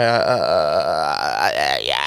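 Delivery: very low, drawn-out drowsy groan; hammed-up dozing off mid-sentence; sleepy. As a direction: let the words sag into a slow, sleepy drone. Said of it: "I'm a sleepy dude".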